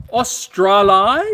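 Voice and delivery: very slow, loud, high Australian caricature; mock Australian accent. Host teases a viewer from Australia, mock Australian accent. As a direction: stretch it out in broad Australian, rising at the end. Repeats country name in put-on accent.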